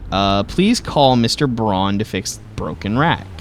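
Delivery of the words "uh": normal talking voice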